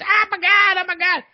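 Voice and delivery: high falsetto wail, fading off. Retold or imitated. Trapped singer wailing to the crew, high falsetto. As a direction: squeal in mock panic, very high. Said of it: "He's doing his [name] voice".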